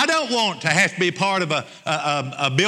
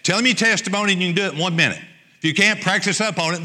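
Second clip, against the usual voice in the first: usual speaking voice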